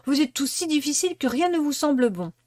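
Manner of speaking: girl defending her bad tea, sulky; sulky Sophie. A narrator's reading. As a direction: snap back defensively. Sophie retorts.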